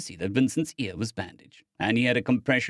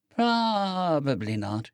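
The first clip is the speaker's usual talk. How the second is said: put-on slow, higher, dreamy voice of a hurt girl; drowsy injured girl; 5 semitones higher, much slower than usual. A narrator's reading. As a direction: speak slowly, hazy on painkillers. Vi agreeing, drowsy.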